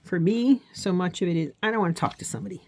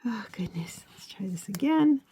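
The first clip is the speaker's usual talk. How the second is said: whispered aside; 5 semitones higher than usual. Real, not acted. Muttering over a craft slip.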